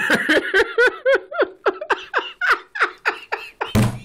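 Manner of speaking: collapse into laughter; rolling giggles; long laughing fit. Real, not acted. Breaks up at porker again.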